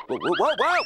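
loud, high startled whoa; startled yell. Real, not acted. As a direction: yelp while losing footing. Falling down stairs.

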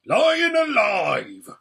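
high, drawn-out dwarf's shout; roaring. A narrator's reading. Dwarf hearing news of the attack, roaring. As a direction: roar it out in alarm. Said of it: "roared the dwarf".

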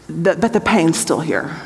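lightly voiced pain, pitched low; understated hurt. Speaker voicing a woman still in pain, low and subdued. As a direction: drop low, gently imitating someone hurting.

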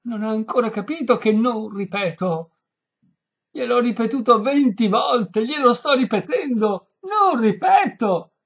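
high, loud desperate protest. A narrator's reading. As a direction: build to a frantic, exasperated shout. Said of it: "disse in tono disperato".

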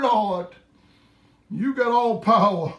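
normal talking voice

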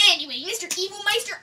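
ordinary talk, nothing marked

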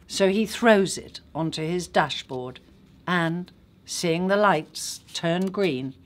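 ordinary delivery

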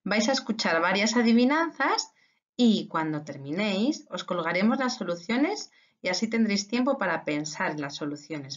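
ordinary talk

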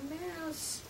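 ordinary delivery, nothing marked

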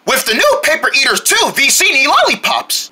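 ordinary talk

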